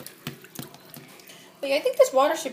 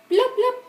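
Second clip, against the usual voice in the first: bubble noises; 8 semitones higher, 5 dB louder than usual. Pretend. Toy voiced blowing bubbles.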